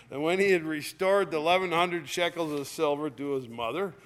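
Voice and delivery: loud, ringing Bible reading; scripture reading. Acted. Preacher reading Judges aloud to the congregation, loud and ringing. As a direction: proclaim the text to the congregation.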